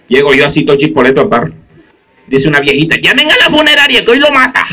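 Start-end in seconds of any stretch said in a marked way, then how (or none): 3.0-4.6 put-on laughing old woman. Old woman voiced in bar.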